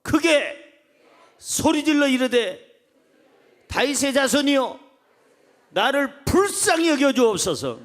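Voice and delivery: high, loud call-and-repeat phrases. Shown as a demonstration. Preacher having congregation repeat the verse, loud and high. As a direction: shout each phrase out for a congregation to echo. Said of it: "더 크게요".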